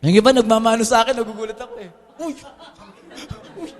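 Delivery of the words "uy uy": high startled cry, mock shock; young pastor startled by churchgoers' blessing, high yelp; surprised yelp. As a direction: act startled, squealing. Startled when people bless his hand.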